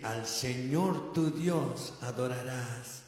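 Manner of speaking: solemn Jesus. Retold or imitated. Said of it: "Jesús le contesta".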